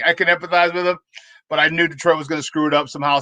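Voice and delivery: ordinary talk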